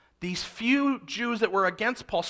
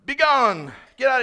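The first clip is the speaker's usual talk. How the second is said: mock-haughty dismissal, louder and higher; dismissive, snobby; 5 semitones higher, 7 dB louder than usual. Shown as a demonstration. Preacher showing the wrong reading of the mob's cry, mock snobby. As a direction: send them off like a hammed-up snob. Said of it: "dismissive and snobby, like, British".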